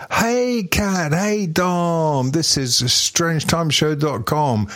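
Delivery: high, bright-voiced podcast intro, slow at the end; hammed-up host greeting, pitched high; show intro voice. Shown as a demonstration. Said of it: "this is normally where I say".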